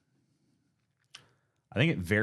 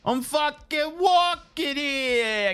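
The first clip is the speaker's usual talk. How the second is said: hammed-up New York street yell, much higher and louder; New York accent; 4 dB louder, slower than usual. Fellow New Yorker answering the request with a New York yell. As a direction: play the outraged New York pedestrian, building to a shout. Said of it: "Fellow New Yorker here".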